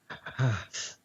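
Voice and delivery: shuddery breath out; demonstrated shiver in the breath. Shown as a demonstration. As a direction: breathe as if freezing cold. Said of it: "little things like this now".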